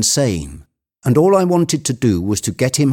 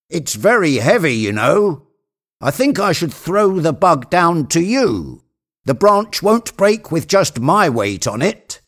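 raised pitch, calling down from above; climber Jupiter; 8 semitones higher than usual. Acted. Jupiter calling down to Legrand from the treetop, raised voice. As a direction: call down from high in a tree.

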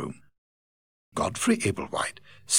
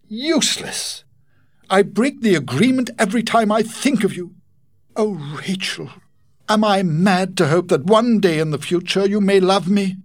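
much higher, louder, impassioned plea; trembling Godfrey; 12 semitones higher, 6 dB louder than usual. Acted. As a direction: pour out a desperate hope. His voice trembled.

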